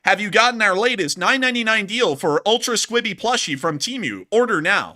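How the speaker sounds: high, loud, cheery AI narrator voice; NPC TikToker voice. Pretend. Streamer explaining the war video in a TikToker voice, high and loud. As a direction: narrate it like a chirpy TikTok bot. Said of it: "I'm going to end up doing the N P C TikToker voice".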